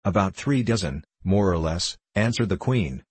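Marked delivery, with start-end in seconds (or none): none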